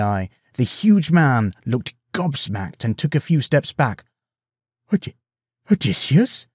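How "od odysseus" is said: stunned, halting question; breathed disbelief. A narrator's reading. Farmer recognises his lost king, breathed in disbelief. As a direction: falter on the name, pitch rising. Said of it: "he breathed".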